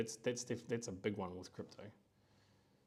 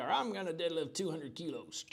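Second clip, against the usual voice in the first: higher-pitched mock American accent; 6 semitones higher than usual. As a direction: imitate an overconfident American gym newcomer. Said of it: "wouldn't say it in an American accent".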